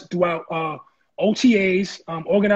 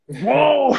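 high, drawn-out startled whoa; retold surprise; 7 semitones higher, 4 dB louder than usual. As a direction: act out being stopped short by surprise. Startled by a second phone call.

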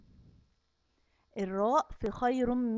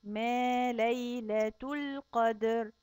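slow, drawn-out tajweed chanting; Quran recitation; much slower than usual. Acted. Teacher reciting Surat al-Qadr to kindergarten kids, slow chant. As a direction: chant each word slowly for the children.

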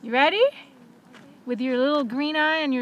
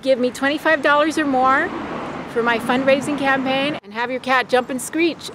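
louder upbeat promo pitch; sales pitch; 7 dB louder than usual. Acted. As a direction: sell the campaign like an ad. Pitches crowdfunding to viewers.

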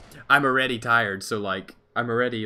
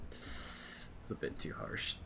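slow, breathless whisper; whispered aside; 6 semitones lower, 13 dB quieter, much slower than usual. Real, not acted. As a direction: mutter it under your breath, slowly. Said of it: "that was a bit too harsh".

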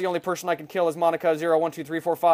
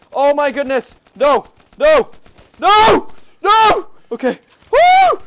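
loud, much higher panicked no's; frantic yelling; 9 semitones higher, 11 dB louder, slower than usual. Real, not acted. Gamer yells at the game while getting killed, frantic. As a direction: scream no over and over as you die. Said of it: "Sorry for yelling".

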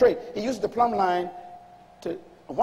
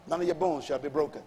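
usual speaking voice

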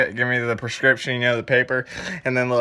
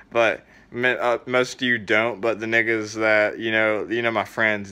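ordinary talk, nothing marked

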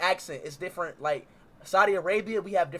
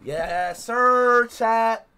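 ordinary talk

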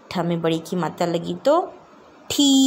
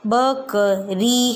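slow syllable drill; much slower than usual. Shown as a demonstration. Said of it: "हम बकरी नहीं".

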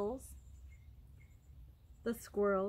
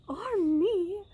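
high, loud butterfly boast; showy, high-voiced brag; bragging; 8 semitones higher, 8 dB louder than usual. A narrator's reading. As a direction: pipe up grandly. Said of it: "bragged the butterfly".

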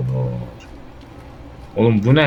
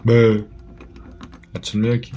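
normal talking voice

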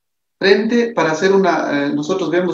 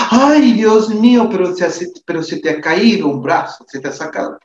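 panicked alarm; 5 semitones higher than usual. Shown as a demonstration. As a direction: gasp at the injury in mock horror. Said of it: "No le diga". (alarma exagerada, aguda y fuerte ante la herida)

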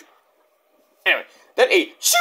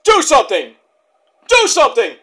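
loud, higher repeated plea; movie line shout; 7 semitones higher, 5 dB louder than usual. Retold or imitated. Vlogger quoting a movie, mock loud plea. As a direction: quote the movie line with a yell. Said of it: "where that movie comes from".

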